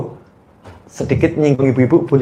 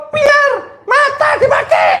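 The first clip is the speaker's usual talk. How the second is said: mock scolding yell. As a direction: yell at your own kid for breaking it. Ibu membentak anak sendiri. (ibu membentak anaknya sendiri dengan keras)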